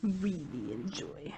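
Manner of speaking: slow soft whisper. Real, not acted. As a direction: whisper it slowly to yourself. Repeating the question to herself.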